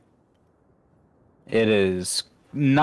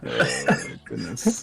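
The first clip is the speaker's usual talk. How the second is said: gossiping housewife; 10 semitones lower, 4 dB quieter, slower than usual. Pretend. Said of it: "You sound like a gossiping, like, housewife".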